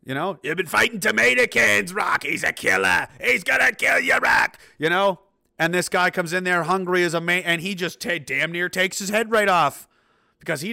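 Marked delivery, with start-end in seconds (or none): 0.4-4.6 loud, high urgent trainer warning; shouted movie-trainer impression; Rocky trainer quote. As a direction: warn Rocky like his frantic trainer. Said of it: "Rocky didn't take Clubber Lang seriously".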